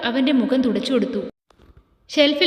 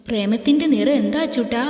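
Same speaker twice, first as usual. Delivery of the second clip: ordinary delivery, nothing marked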